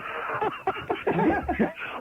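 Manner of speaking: high giggling fit; real laughter, can't stop; a caller in stitches; helpless laughter. Real, not acted. Caller cracking up at interview.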